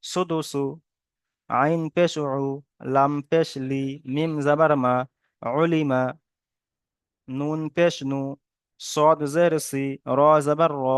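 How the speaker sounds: measured syllable-by-syllable spelling drill; spelling recitation. Shown as a demonstration. Teacher shows students how to read joined letters, measured spelling recitation. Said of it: "কেমন ভাবে পড়তে হবে".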